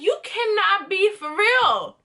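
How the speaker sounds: can't believe what she hears; disbelief. Retold or imitated. Disbelief at her ex's demand.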